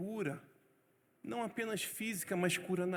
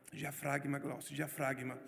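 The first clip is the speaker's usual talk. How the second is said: coaching himself; 7 semitones lower, 5 dB quieter than usual. Retold or imitated. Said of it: "treinando na frente do espelho".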